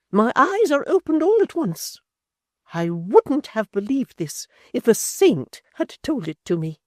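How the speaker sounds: high, loud indignant matron; a wounded lady turning cold and grand; high, loud offended dowager; Missus Chick, outraged. A narrator's reading.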